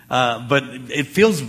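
ordinary delivery

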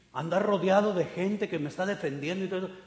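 usual speaking voice

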